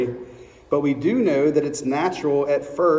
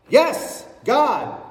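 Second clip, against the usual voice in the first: God's voice; 8 semitones higher than usual. Acted. God answers.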